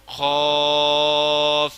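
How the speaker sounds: قاف thick from the back of the throat, drawn very slow; heavy qaaf. Shown as a demonstration. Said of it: "موٹا کر کے پڑھنا ہے ہلکی طرف سے".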